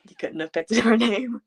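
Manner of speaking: laughing through the words; amused complaint broken by a laugh. Real, not acted. Joking to co-host about the parents' name choice, laughing.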